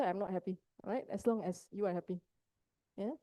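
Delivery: ordinary talk, nothing marked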